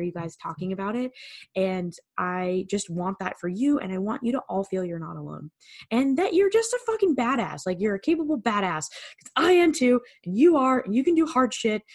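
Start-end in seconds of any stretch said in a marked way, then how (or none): 5.9-11.8 higher and louder, rallying the listeners; excited, louder and higher encouragement; fired-up pep talk. Real, not acted. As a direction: cheer them on like a hype coach. Hyped pep-talk ending.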